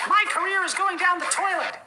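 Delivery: high, strangled falsetto lament; mock despairing squeal. Reviewer in mock despair about his career, in a high squealing voice. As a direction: squeak it out like a ruined man.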